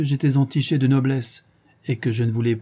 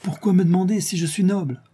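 joyful; 8 semitones higher than usual. A narrator's reading. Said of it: "d'un air joyeux".

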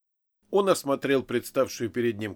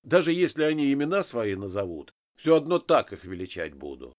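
usual speaking voice

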